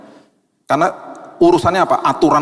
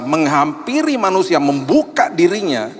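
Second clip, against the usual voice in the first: ordinary delivery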